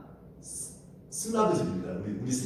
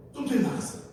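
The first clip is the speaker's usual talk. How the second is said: high, urgent fake helpfulness; putting on an act; 13 semitones higher, 5 dB louder, faster than usual. Rahab misleading the detectives into a chase, high urgent act. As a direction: send them off with hammed-up urgency. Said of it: "연기를 기가 막히게".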